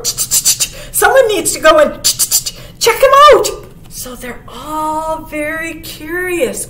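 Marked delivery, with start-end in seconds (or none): 0.0-3.5 stuttered ch-ch-ch rising to a high shout; chattering squirrel. A narrator's reading. Squirrel urging someone to check him out, chattering. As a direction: chitter like a squirrel, then burst out loud. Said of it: "chattered the squirrel".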